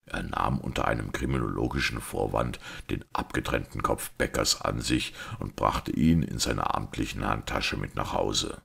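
usual speaking voice

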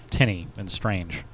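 normal talking voice

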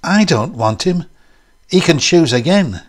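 high, loud, bitter protest; hurt, hopeless outburst; bitter hopelessness. A narrator's reading. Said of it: "with the same rather bitter hopelessness".

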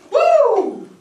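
loud, high "woo"; excited whoop. Acted. Celebrating a date out loud, excited whoop. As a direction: let out a soaring celebratory whoop.

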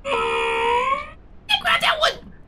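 long, drawn-out, very high yell; straining shout. Real, not acted. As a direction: stretch it out, willing the jump to land. Straining to make a jump.

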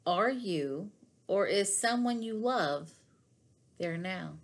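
ordinary talk

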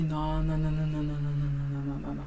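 low monotone rattle of denials; stunned denial. Retold or imitated. Man reacts to her virginity, low droning imitated denial. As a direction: drone it low, fading out.

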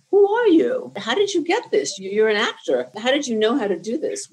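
high, loud, thrilled amazement at a surprise actor; amazed exclaim. Retold or imitated. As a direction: gush in disbelief at a newcomer's talent. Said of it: "the people there said to me".

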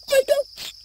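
sudden very high squeak; squeaky yelp. Acted. Sudden outburst while confessing.

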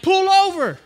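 road-rage order to pull over; retold angry demand. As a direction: yell it at the other driver. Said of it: "I was like".

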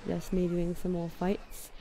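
put-on amateur London accent, done for fun; playful, imperfect London accent. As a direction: talk like a Londoner out of Harry Potter. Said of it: "a London accent".

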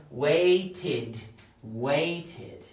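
"waited" drawn out as wait-id; "waited" in two separated syllables. Shown as a demonstration. Teacher showing esto se pronuncia, "waited" said slowly as wait-id. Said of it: "Entonces esto se pronuncia".

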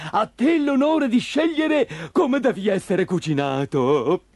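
marvel, chuckle, then make a mock-courteous offer; amused monster fisherman. Fisherman letting the puppet choose how he's cooked, gleefully amused.

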